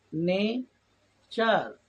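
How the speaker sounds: নেচার in two long, loud beats; split into syllables. Shown as a demonstration. Teacher demonstrating syllables to learners, নেচার very slow and divided. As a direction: draw out নে, pause, then hit চার. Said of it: "syllable ভাগ করে করে উচ্চারণ".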